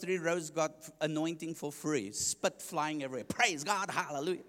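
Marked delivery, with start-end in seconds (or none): 3.2-4.4 mild mock preacher's shout; loud preacher imitation. Preacher jokes about the volume seventeen preacher, mock-loud praise. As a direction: give a quick impression of a booming preacher. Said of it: "he was a volume seventeen".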